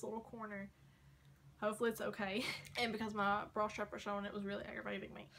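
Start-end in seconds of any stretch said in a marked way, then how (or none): none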